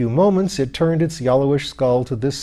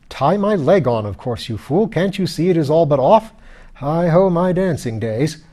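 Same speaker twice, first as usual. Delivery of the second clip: higher, louder, aggrieved; indignant lord; 5 semitones higher, 5 dB louder than usual. A narrator's reading. Lord rebukes his heartless wife, indignant. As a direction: hurl it at her like a wounded grandee.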